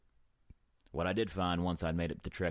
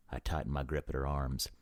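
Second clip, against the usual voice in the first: ordinary delivery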